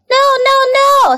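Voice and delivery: loud, very high protest; alarmed goose. A narrator's reading. Goose stops the pig nearing the pail, alarmed. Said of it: "said the goose".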